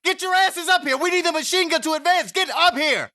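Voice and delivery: high, urgent battlefield scream; screamed command. Acted. Blue Devil ordering his lagging men up to advance, screamed command. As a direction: scream orders at the top of your range, strained and urgent. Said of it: "Screaming to the heavens, he commands".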